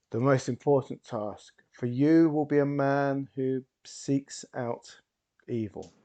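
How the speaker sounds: slow, theatrical pronouncement with long pauses; showman hypnotist. Acted. Stage hypnotist bestows a gift on Esposito, grand and slow. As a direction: open loud, then draw the words out grandly.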